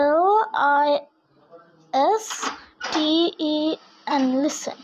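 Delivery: letters spoken one at a time with pauses; slow spelling. Shown as a demonstration. Teacher spelling listen for the class, slow with pauses. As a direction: spell it slowly for learners. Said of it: "টিটা silent".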